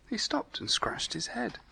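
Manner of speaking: unvoiced storyteller whisper. A narrator's reading. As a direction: whisper it like a secret to the child. Narrator whispers story line.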